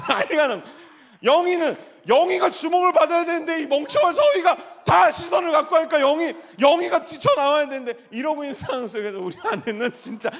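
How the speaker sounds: high, loud laughing shout; excited laughing shout. Real, not acted. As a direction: shout it while laughing at the chaos. Laughing shout describing the scene.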